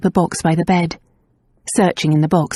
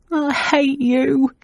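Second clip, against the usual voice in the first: slow, much higher whimper; 8 semitones higher, much slower than usual. A narrator's reading. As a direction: whimper it through sickness. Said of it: "Nan whimpered".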